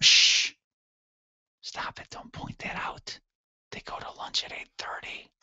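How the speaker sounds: urgent hushing, then a very soft whisper. Acted. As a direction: shush them and whisper it secretively. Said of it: "why are we whispering".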